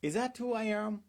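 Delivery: mock-astonished, pitch shooting up; incredulous surprise. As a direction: act stunned at the compliment. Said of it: "and you are like".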